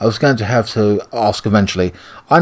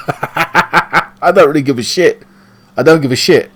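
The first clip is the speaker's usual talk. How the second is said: laughing; 4 semitones higher than usual. Real, not acted. Laughing at the game.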